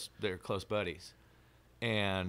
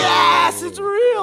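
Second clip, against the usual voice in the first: excited shout; 17 dB louder, much slower than usual. Retold or imitated. Excited reaction to alien confirmation.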